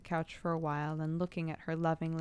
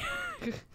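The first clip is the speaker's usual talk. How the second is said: laughing; 3 dB quieter than usual. Real, not acted. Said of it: "I'm sorry".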